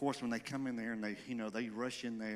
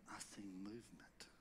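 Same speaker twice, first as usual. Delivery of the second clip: whispered, awed; hushed whisper; 3 semitones lower, 17 dB quieter than usual. Real, not acted. Preacher hushes recalling the first movement, whisper. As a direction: breathe it out in awe.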